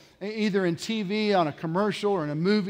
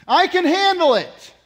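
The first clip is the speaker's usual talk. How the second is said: high, loud mock protest; defiant insistence; 9 semitones higher, 8 dB louder than usual. Addict brushing off grandma's help, loud defiant insistence. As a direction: insist loudly, refusing all help.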